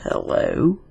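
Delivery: breathy, rasping phone voice; a creepy stranger's rasp; raspy male voice. A narrator's reading. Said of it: "a raspy male voice said".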